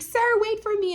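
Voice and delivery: high-pitched mock plea; pleading call. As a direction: lightly imitate a helpless girl calling after someone. Helpless girl calling after man.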